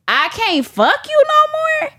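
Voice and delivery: worked-up, high-pitched outburst; emphatic disbelief. Shown as a demonstration. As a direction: push it up high in protest. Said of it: "when I say it these three different ways".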